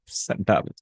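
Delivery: ordinary talk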